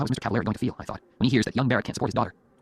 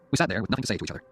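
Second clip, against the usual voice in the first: usual speaking voice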